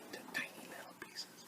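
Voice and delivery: quick, soft whisper; whispered aside to listeners. Acted. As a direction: drop to a secret whisper. Trails into a whisper.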